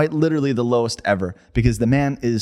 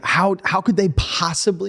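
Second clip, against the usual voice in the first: higher, worked-up protest; yelling incredulously; 8 semitones higher than usual. Real, not acted. Co-host disputing pandemic prediction, yelling in disbelief. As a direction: demand it in rising outrage. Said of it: "don't yell at me".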